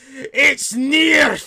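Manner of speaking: very high, very loud panicked scream; panicked shouting. Acted. Dwarf shaking Nolek about the beast, panicked scream. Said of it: "just kinda, like, shake him".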